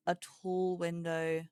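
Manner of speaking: ordinary delivery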